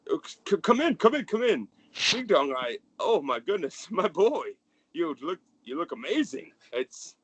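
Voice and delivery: high, merry fatherly gush; jolly father. Acted. The merry father welcoming his son home, high and gushing. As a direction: beam over the boy like a proud dad.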